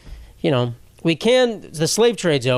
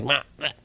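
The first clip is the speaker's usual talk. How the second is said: frog noise; 6 dB quieter than usual. Acted. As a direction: croak softly like a frog. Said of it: "That's a frog noise".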